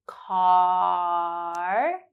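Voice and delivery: "Car" with a long drawn-out ah; held ah. Shown as a demonstration. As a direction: stretch the ah of "Car". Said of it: "ah sound at the end".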